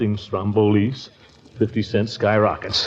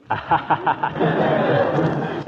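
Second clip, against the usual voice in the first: breathy nervous titter facing the toy cannon; nervous chuckle; 4 semitones higher than usual. Acted. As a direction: laugh it off uneasily, unvoiced.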